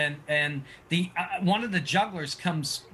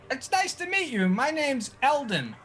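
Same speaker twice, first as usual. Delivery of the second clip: high, bright introduction; cheerful juggler; 7 semitones higher than usual. Acted. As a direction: greet them as showman Eldon.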